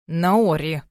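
usual speaking voice

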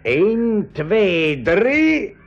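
rising count, last number high; tense count. Acted. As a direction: build the count to the throw. Counting down the grenade throw.

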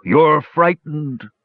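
slow, high, sorrowful observation; sad dragon. A narrator's reading. Dragon seeing the brothers' fear, speaking sadly. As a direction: draw it out sadly. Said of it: "said the dragon sadly".

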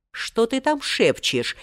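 slow, high-pitched suspicious query. A narrator's reading. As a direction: question him warily, drawing it out. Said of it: "подозрительно спросил старик". (подозрительно)

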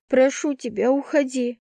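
plain reading voice

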